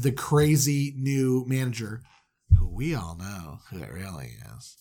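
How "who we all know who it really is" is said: mysterious teaser. Acted. Teasing mystery cliffhanger.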